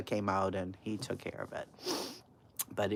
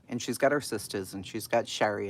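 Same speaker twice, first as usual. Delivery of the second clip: usual speaking voice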